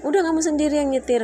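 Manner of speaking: retold husband snapping at her; mock angry snap. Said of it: "Dia bentak saya".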